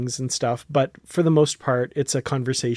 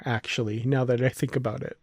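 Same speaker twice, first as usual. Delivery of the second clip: normal talking voice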